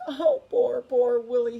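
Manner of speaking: loud, tearful lament; almost in tears. A narrator's reading. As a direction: cry out in pity. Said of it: "was almost in tears".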